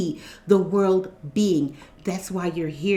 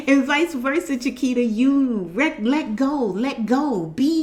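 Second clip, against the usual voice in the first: laughing; 5 semitones higher than usual. Real, not acted.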